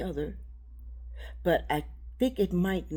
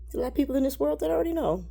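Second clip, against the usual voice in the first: emotion breaking through; 8 semitones higher, 4 dB louder, faster than usual. Real, not acted. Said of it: "not to let my emotions take over my voice".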